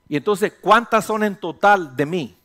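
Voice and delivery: ordinary talk, nothing marked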